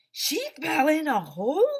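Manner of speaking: a stunned shout to the teacher; shocked disbelief. Acted. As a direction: cry out the question in disbelief. Shocked reply to Harrison's news.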